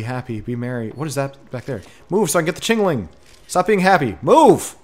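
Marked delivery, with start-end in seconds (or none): 2.1-4.8 high, loud frustrated yelling; exasperated shout, loudest on the last word; impatient shouting. Real, not acted. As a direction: order them out of the way, building to a yell. Yelling at creatures blocking Chingling.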